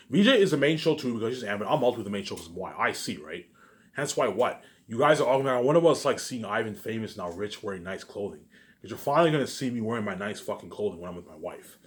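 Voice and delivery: ordinary talk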